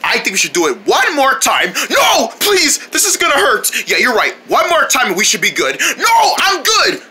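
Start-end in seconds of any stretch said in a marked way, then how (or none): 1.9-3.7 high-pitched plea; panicked begging. Acted. Patient begs against another shock. 6.0-7.0 high, drawn-out protest; panicked refusal. Acted. Patient refuses another shock.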